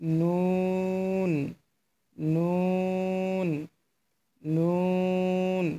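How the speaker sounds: নুন drawn out long; teacher modelling নুন slowly; slow letter demo. Shown as a demonstration. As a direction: stretch নুন slowly for learners. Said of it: "এই হরফটি হবে".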